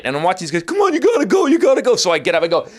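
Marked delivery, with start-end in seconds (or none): 0.6-2.0 high, squeaky urging; hurried mock-teen plea; pleading young bouncers. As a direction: pipe it up over the fight. Acted young bouncers pleading in melee.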